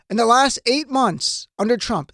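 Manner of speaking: normal talking voice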